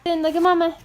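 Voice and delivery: high, loud mommy voice. Real, not acted. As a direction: perk up for the little ones. Mother talking to her kids.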